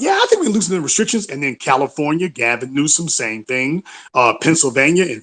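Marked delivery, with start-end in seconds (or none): 0.0-1.3 higher, quick mocking Biden quote. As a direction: toss it off breezily as Biden. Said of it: "But Biden talking about".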